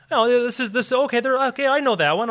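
high, eager audience chatter; quick dawning recognition, acted out; imitated recognition. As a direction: mimic a delighted moviegoer. Voices audience recognising American actors.

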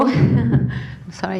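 ordinary delivery

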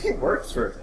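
laughing through the words. Real, not acted. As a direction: chuckle while saying it. Repeats line laughing.

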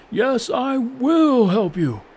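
high, loud, slow cry; cried out. A narrator's reading. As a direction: cry out with full heart. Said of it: "cried the cloud once more".

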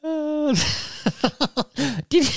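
high squeaky laugh. Real, not acted. Host laughing at co-host's piss analogy, high laugh.

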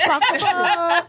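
slow, high car-honk imitation; drawn-out mock honk; imitated honking. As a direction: honk it out like a horn.

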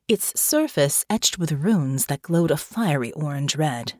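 usual speaking voice

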